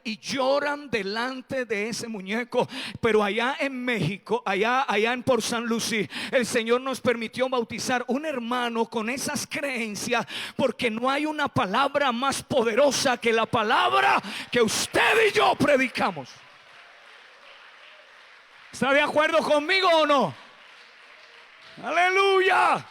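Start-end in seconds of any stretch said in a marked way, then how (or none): 3.0-20.3 rising to a shouted, high preacher's call; fiery preaching, louder and higher. Acted. Pastor preaching at full force. 21.8-22.8 slow, high, loud aleluya; shouted hallelujah. Acted.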